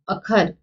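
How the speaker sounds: अखर said light; without addhak. Shown as a demonstration. Teacher shows अखर without addhak, single kh. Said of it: "ट पे जोर पड़ेगा".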